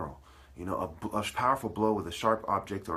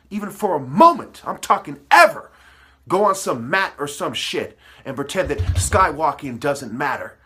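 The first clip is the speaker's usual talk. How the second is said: louder and louder until he is bellowing; furious shout; 7 semitones higher, 11 dB louder than usual. Real, not acted. Ranting at his enemies' descendants, roaring furiously. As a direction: yell it, rising to a full roar. Ranting at rivals, building to yelling.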